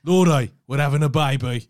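loud, hammed-up Brummie impression; insistently overdone Brummie; Brummie accent. Shown as a demonstration. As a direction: belt it out in mock Birmingham accent. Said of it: "It's spot on".

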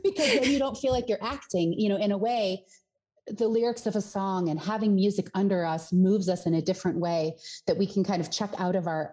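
normal talking voice

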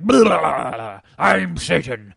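loud gravelly devil voice; Satan voice. Retold or imitated. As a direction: boom it out like a Batman-style Satan. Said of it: "I'm like".

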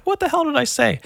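very high baffled quote; indignant younger self. Retold or imitated. Kid answering skate park scolders, high indignant protest. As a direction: imitate a kid's high-pitched protest. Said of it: "I literally said".

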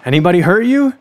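lightly voiced soba seller; brusque questioning. A narrator's reading. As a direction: question the frightened stranger. Soba man questions frightened runner.

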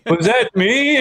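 high, laughing, sheepish question. Pretend. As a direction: squeak out a guilty question. Imagined guilty culprit.

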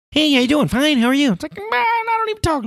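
very high, loud falsetto mock greeting; squeaky fake voice. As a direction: squeal the fake pleasantries, hammed-up. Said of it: "Someone coming up to you and be like".

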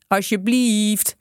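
slow, stretched begging please; drawn-out pleading. A narrator's reading. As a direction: drag the word out as you beg. Gang begs, dragging it out. (langgerekt smekend)

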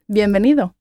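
usual speaking voice

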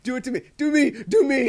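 high, loud, laughing dare; playful laughing. Real, not acted. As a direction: tease through laughter.

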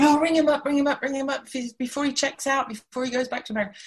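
put-on high, fast begging teen; squealing schoolgirl plea; excited pleading. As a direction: urge him on, breathless and eager. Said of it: "So then I said".

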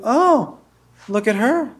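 high teasing third-person jab; forties teasing. Pretend. Comic imitating forties banter, lightly voiced. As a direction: tease like a forties wisecracker. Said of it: "they did a thing with humor".

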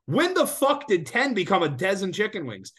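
indignant disbelief at ten wings; exasperated rant. Real, not acted. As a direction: let the outrage spike up high. Ranting about chicken wing counts.

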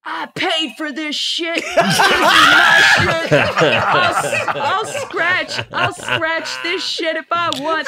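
high, loud mock tantrum through laughter; imitated angry husband. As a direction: imitate a husband shouting possessively. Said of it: "He's like".

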